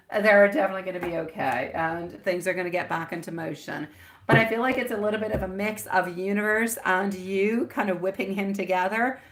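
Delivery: ordinary delivery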